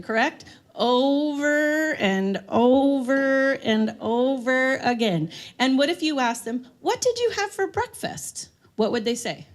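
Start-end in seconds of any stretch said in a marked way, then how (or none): 0.7-5.3 drawn-out repetition. Acted. Mocking repeated questions. 6.8-8.5 higher, slow, coaxing question; sing-song carer question. Acted.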